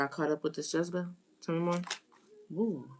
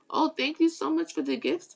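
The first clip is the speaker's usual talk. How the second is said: high, bright thank-you; cheerful thank-you to a livestream viewer; delighted thanks; 10 semitones higher than usual. Real, not acted. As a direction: gush over the gift from a viewer.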